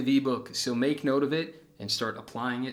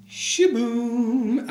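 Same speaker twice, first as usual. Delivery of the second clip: high, loud playful Shaboom; a loud, high, playful cry; excited shout; 12 semitones higher, 7 dB louder than usual. Real, not acted. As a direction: burst in like a magic reveal. Playful outfit change exclamation.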